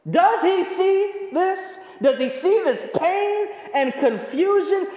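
high, stretched, pleading questions; desperate cry. Retold or imitated. Preacher voicing a hurting believer, high desperate pleading. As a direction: cry out like someone lost in pain. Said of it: "you're like".

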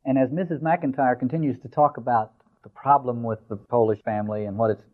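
usual speaking voice

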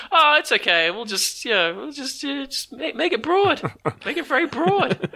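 high-pitched mock indulgent voice; careless sister city. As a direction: squeak it like someone shrugging off sloppy work. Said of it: "But then with the other sister".

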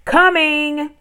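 high, loud, sustained call; shouted reply. A narrator's reading. Girl answers her mother from the window, shouting. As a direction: holler back across a distance. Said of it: "out the window and shouted".

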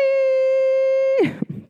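very high, light giggle. Real, not acted. Laughing at her own touchy squeak to viewers, high giggle. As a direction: giggle it off in a tiny voice.

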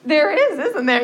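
high, loud mock-sarcastic aside; pretend-agree with an eye roll in the voice; teasing put-on sarcasm. Mocking reply to piano answer.

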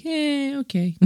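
high, soft, noncommittal "eh"; a lukewarm, half-hearted verdict; unimpressed meh. Acted. Judging an actor's weaker movies, lukewarm and unimpressed. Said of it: "movies where you're kinda like".

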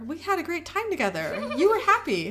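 high, insistent Becky-style protest; pleading self-defence. A narrator's reading. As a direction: protest your innocence, pitched high. Becky defends herself to Sam.

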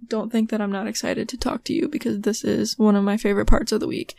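ordinary talk, nothing marked

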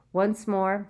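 usual speaking voice

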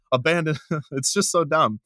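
ordinary delivery

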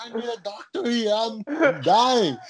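high, drawn-out comic plea; hammed-up panic with laughter behind it; mock panic. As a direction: exaggerate a desperate cry for help. Retold panicked plea at hospital.

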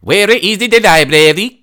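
slow, high, loud put-on Norwegian accent. Shown as a demonstration. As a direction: lay on a thick Norwegian accent, slowly. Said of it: "I want you to try".

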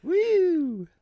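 long, very high "woo"; high whoop. Real, not acted. Laughing at becoming a shape, high whoop. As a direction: let out a loud, high whoop.

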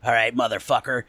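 loosely imitating a friend's bad impression of Chris; Nick's bad impression. To the audience, a light copy of Nick's impression of Chris, loosely imitated. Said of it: "he kinda talks like this".

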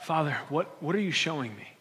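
hushed, voiced near-whisper prayer; softly and confidently ask the Father; acted quiet, trusting question. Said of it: "whispering confidently".

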